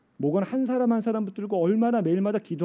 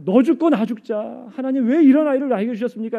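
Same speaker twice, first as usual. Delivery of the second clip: pleading up at God, higher and louder; retold desperate lament, raised voice; tearful reproach; 7 semitones higher, 8 dB louder than usual. Exhausted parent crying out to God over the child, reproachful. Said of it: "원망하면서 눈물 흘리면서".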